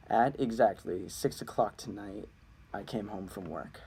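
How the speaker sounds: ordinary delivery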